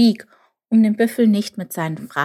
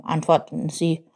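usual speaking voice

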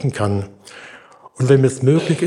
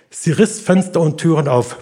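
ordinary talk, nothing marked

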